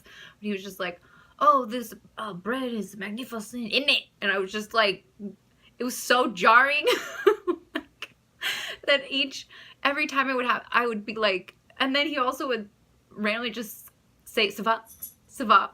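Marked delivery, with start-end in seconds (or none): 1.4-4.0 lightly put-on British accent, half French; a bad British-French mix of accents. Mimicking the French date's British-accented talk. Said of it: "apologize for these bad accents". 5.8-10.5 high, laughing through the words. Real, not acted. Recalling the awkward date, laughing. 14.5-15.7 quick French. Retold or imitated. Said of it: "really fast".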